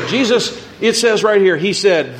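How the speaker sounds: ordinary talk, nothing marked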